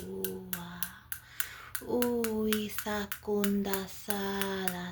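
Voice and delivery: slow, drawn-out chant with long vowels; channelled light language. Acted. Channelling Mintakan and Andromedan star mothers, slow chanting. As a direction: stretch each syllable into a flowing incantation.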